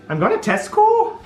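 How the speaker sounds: high, slow mock Welsh accent; hammed-up sing-song Welsh lilt. Shown as a demonstration. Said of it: "I can't do that in a Welsh accent".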